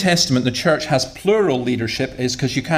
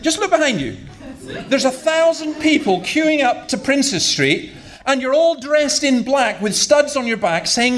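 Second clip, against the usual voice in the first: heated retort; 11 semitones higher than usual. Retold or imitated. Retorting to mate in queue.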